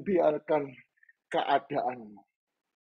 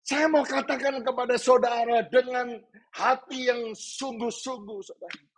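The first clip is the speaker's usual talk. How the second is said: full-force preaching; 12 semitones higher than usual. Real, not acted. Preacher urging congregation not to let problems silence them, full-force preaching. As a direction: urge them with mounting force.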